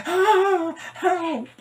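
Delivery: high, loud mock distress call; mock cry for help. On the walkie-talkie after a fall, a hammed-up high cry. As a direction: play-act a fall and shout for help.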